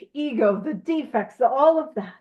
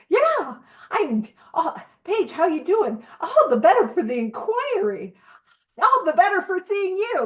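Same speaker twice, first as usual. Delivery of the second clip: mock chirpy, higher, laughing pleasantry; fake cheerful greeter; 6 semitones higher than usual. Mocking a newcomer brushing off Paige's question, chirpy and fake. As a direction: ham up a too-bright hello. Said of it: "we know when somebody's like".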